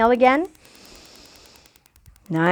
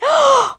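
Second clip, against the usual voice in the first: mock gasp; 11 semitones higher than usual. Spotting the cobra.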